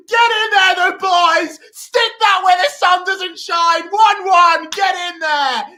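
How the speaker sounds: high, loud laughing yells; goal celebration shout. Real, not acted. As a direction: scream with joy at the goal.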